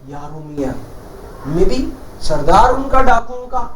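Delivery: ordinary delivery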